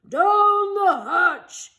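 loud slow crew chorus; crew shout. A narrator's reading. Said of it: "we all shouted".

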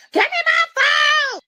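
high, slow shrieking demand; screaming girl. Retold or imitated. Little girl screaming at him for her phone. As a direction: shriek it like a furious kid. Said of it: "screaming and hollering like".

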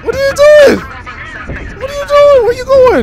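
high loud yelling at driver; road rage yelling. Real, not acted. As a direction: scream at the bad driver.

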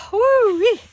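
high, loud woo-wee of relief; loud excited whoop. Real, not acted. Crafter whooping after hauling her heavy folder, excited. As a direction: let it burst out in triumph.

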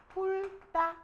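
훑다 said as "hul-tta", high and deliberate; pronunciation demo. Shown as a demonstration. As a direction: say 훑다 with ㄹ kept, tense "tta". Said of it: "이렇게 발음하는 거야".

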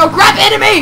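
alarmed call-out. Real, not acted. Player warning teammate of an enemy, alarmed call-out. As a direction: yell it out in sudden urgency.

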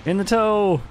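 high, loud triumphant shout; gleeful call-out. Real, not acted. Player celebrating a hit on the toe, gleeful shout.

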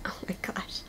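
breathless awed whisper. Real, not acted. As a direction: whisper it, too cute for words. Overwhelmed by the robot's cuteness.

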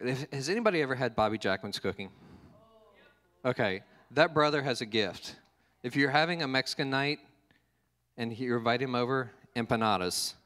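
usual speaking voice

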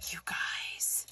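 hushed, voiceless disbelief; awestruck whisper. Real, not acted. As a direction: whisper it as if overwhelmed. Overwhelmed by the gift.

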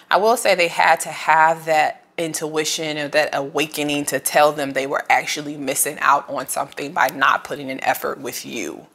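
ordinary talk, nothing marked

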